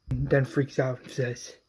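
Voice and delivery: plain reading voice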